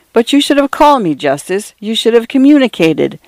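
high-pitched, insistent protest; agitated reproach. A narrator's reading. Brother reproaches the narrator, agitated.

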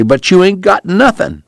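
high-pitched, agitated protest; excited. A narrator's reading. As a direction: burst out in excited objection. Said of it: "Pa said excitedly".

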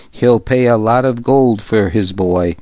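ordinary delivery

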